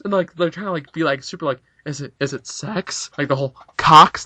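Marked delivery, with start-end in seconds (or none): 1.8-3.1 slow and softer, tentative and shy; timid classmates murmuring the taboo word; mock hushed question. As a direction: ask it like a nervous classmate afraid to say it. Classmates whispering the shocking word.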